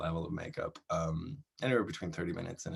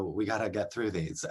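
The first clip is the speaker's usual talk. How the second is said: ordinary talk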